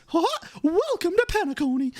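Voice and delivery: high, jolly cartoon mascot; Clocky. Mascot welcoming guests to Penacony, high and jolly. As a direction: hail the crowd in a high cartoon voice. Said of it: "Clocky is".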